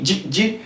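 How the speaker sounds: soft short J, no vowel after it; quietly touch J, no vowel; bare consonant J. Shown as a demonstration. Teacher drilling the class on a bare J. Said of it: "you simply say J".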